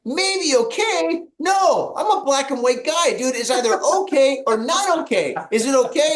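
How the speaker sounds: high-pitched, incredulous retort; hammed-up demand for a straight answer; exasperated disbelief. Son-in-law challenging father-in-law's maybe okay, exasperated and high.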